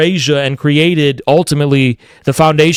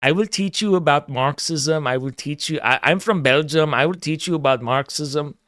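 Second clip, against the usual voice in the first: lightly voiced, hushed European know-it-all; a softly spoken, mock-instructive outsider; lecturing Belgian newcomer; 3 semitones lower, 7 dB quieter than usual. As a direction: quietly imitate a condescending Belgian teacher. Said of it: "somebody comes to America and is like".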